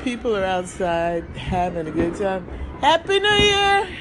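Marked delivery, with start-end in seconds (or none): 2.8-3.9 high shouted greeting; new year cheer. Real, not acted. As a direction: call it out across the street. Shouting to neighbor across street.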